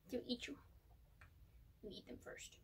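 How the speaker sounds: unvoiced conspiratorial whisper; whispered aside. Real, not acted. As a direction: lean in and whisper the joke. Whispered joke to ferrets.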